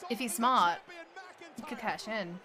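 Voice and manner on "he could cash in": ordinary delivery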